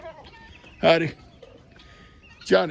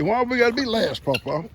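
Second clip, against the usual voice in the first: a lightly voiced, higher chicken asking; chickens complaining; 7 semitones higher than usual. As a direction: play a hen who feels left out. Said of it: "They say".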